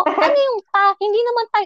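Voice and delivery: high-pitched retold protest; arguing with mother. Daughter questioning mother's word, arguing. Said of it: "nag-away pa kami ng nanay ko".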